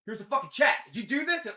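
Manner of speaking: a hammed-up angry marine; mock angry accusation. Marines accusing, louder higher.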